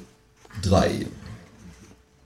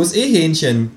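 much higher, cheeky kangaroo; 9 semitones higher, 3 dB louder than usual. Acted. Kangaroo reassuring the narrator, high and cheeky. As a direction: pitch way up for the brash kangaroo.

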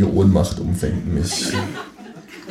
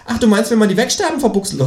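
high, quick kangaroo chatter; cheeky kangaroo voice; 4 dB louder, faster than usual. A narrator's reading. Kangaroo teasing narrator with garbled words, high cartoon voice. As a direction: rattle it off as a talking kangaroo.

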